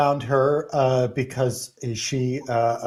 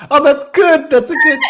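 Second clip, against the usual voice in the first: delighted; 13 semitones higher, 8 dB louder than usual. Real, not acted. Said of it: "Wait, what? I thought it was good".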